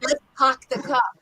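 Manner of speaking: New York "park the car" with no r's; New York accent. Shown as a demonstration. Teacher shows the second way, New York accent. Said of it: "and then say".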